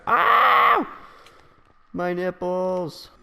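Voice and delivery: high, loud yelp, then slow; mock pained yelp. Teasing a friend, yelping as him in mock pain. Imitating Jaden tugging nipple rings.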